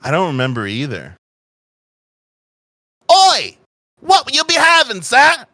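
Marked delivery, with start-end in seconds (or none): 3.1-5.4 loud, high Cockney robot bartender; booming mock-Cockney barman greeting a customer; bad Cockney accent. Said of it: "loud as shit in his bad Cockney accent".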